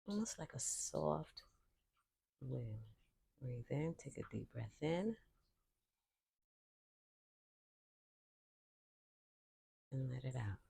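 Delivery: calm, unhurried voice with long silences between cues; guided meditation. Real, not acted. Yoga teacher leading listeners into breathing, calm guided meditation. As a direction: count the breaths softly, leaving room to breathe.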